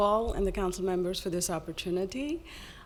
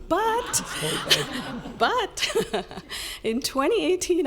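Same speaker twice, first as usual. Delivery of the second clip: real laughter breaking up the words, much higher; giggling; 10 semitones higher, 4 dB louder, slower than usual. Real, not acted. Joking to the mayor about voting, giggling. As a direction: laugh through it, then push on.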